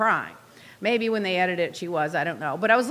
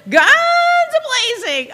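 slow, laughing, higher and louder; 9 semitones higher, 8 dB louder, much slower than usual. Real, not acted. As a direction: stretch it out through a laugh. Laughing about haters attacking again.

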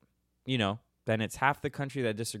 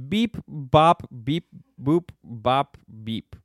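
robot beeps; 5 dB louder, much slower than usual. Pretend. Answering how aliens make music.

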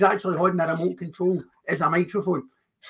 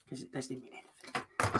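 whispered; 5 semitones lower, 17 dB quieter than usual. Acted. Whispered within promo.

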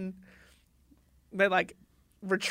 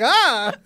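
loud, higher "ah" of fright; startled mock scream; 4 semitones higher, 9 dB louder than usual. Knights turning around and seeing danger, mock scream. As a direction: imitate knights yelping in surprise. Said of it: "as in surprised and scared".

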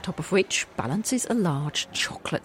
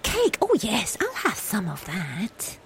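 greedy character voice; 4 semitones higher, slower than usual. Acted. Character asking for cake.

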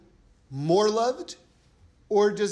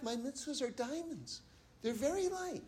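much softer, higher, gentle voice of God; God's soft reply; 5 semitones higher, 12 dB quieter than usual. Retold or imitated. God gently answers the complainers, soft and light. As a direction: answer softly, as a loving God. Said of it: "And God says back".

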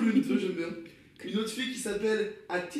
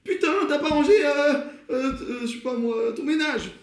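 higher, louder, playful nagging shout; mock quarrel; 9 semitones higher, 6 dB louder than usual. Shown as a demonstration. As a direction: scold a crewmate about chores. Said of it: "Une petite engueulade dans le vaisseau". (engueulade pour rire, plus aiguë et plus forte)

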